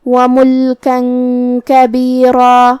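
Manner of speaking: وَمُلْكًا كَبِيرًا very slow, the tanween hidden in a nasal hum before ك; وَمُلْكًا كَبِيرًا drawn out, nasalised tanween before كَبِيرًا; ikhfa with ghunna. Shown as a demonstration. Teacher demonstrates ikhfa to students, slow and nasal. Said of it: "تنوین کو چھپاتے ہوئے اور غنہ کے ساتھ".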